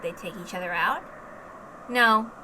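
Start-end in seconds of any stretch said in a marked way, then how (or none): none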